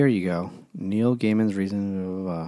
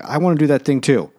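higher, louder retold eager wish; mock eagerness; 6 semitones higher, 6 dB louder than usual. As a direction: imitate someone keen to join in. Mimicking Gaiman wanting the same.

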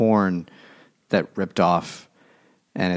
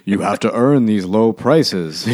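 mock Minotaur; 4 dB louder than usual. Voicing Minotaur as mall guard.

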